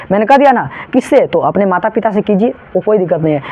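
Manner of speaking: usual speaking voice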